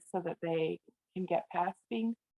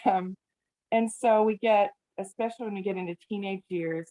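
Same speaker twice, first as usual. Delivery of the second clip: ordinary talk, nothing marked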